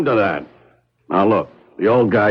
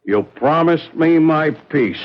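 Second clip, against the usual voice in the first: normal talking voice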